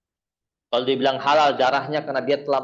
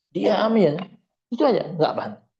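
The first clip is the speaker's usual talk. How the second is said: usual speaking voice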